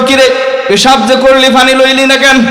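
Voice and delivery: high needling questioner voice; questioning disciple. Retold or imitated. Disciple questions the pir about water, high-pitched. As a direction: poke at him with the question. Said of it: "জিজ্ঞাসা করলো".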